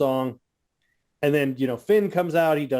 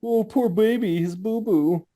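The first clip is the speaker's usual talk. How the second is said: higher, cooing mock pity; mocking baby talk; 6 semitones higher than usual. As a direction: sneer like comforting a baby. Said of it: "dying because I'm like".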